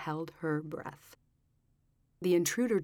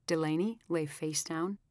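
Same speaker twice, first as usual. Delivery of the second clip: usual speaking voice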